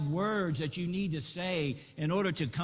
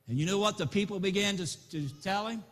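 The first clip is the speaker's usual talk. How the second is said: ordinary delivery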